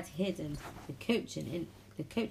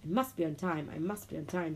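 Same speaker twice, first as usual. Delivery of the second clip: ordinary delivery, nothing marked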